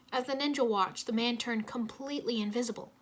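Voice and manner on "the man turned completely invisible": usual speaking voice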